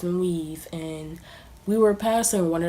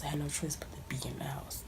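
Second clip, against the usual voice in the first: whisper; 3 semitones lower, 11 dB quieter than usual. Real, not acted. Quiet aside about being stuck.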